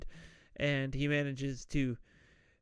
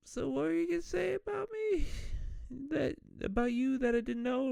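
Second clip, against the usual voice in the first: Marian's voice pitched way up, quick and sweet; high girly Marian voice; 11 semitones higher, faster than usual. Retold or imitated. A woman coaxing her sweetheart to confess, in a high sweet voice. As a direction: coax him like a lady in a musical. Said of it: "She's like".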